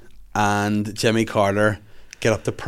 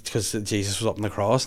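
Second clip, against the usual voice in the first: normal talking voice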